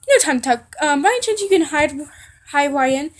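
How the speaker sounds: loud, hurried acted request; urgent plea. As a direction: rush it out loudly, no time. Hanji hurriedly begs for help.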